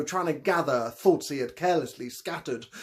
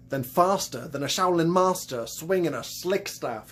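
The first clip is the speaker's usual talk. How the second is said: usual speaking voice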